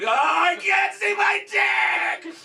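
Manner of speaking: high, loud, stretched panic; high shout of out-of-body panic; panicked shout. Retold or imitated. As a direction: yell it as your soul floats away. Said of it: "looking down at myself sleeping like".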